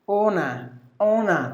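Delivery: "ona" in British, no R at the end; British pronunciation. Shown as a demonstration. Teacher showing British honor, British pronunciation. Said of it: "That's British, ona".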